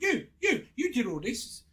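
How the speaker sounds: high, loud jabbing accusation, imitating the customer; hammed-up furious customer pointing the finger; mock angry accusation. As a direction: throw the blame at him in a shrill mimic. Irate customer accusing the driver.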